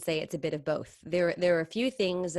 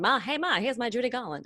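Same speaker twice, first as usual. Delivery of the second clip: put-on child calling out; 6 semitones higher, 3 dB louder than usual. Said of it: "I never ran in the room and went".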